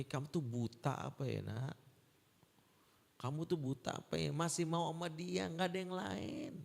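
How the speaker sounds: retold mother scolding her daughter, pitch climbing; exasperated scolding. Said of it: "saya mau ngomel".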